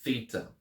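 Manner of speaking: Theta begun with unvoiced th as in thin, THEE-tah; hard th Theta. Shown as a demonstration. Teacher modelling Theta with hard th. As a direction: start Theta with the breathy th of thin. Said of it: "T H sound as in throne or thin".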